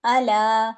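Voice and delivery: अला with a long final a; correct reading. Shown as a demonstration. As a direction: say अला, ya silent. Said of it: "इस तरीके से मैंने इसको पढ़ना है".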